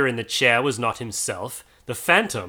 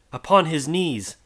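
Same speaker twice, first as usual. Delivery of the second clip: usual speaking voice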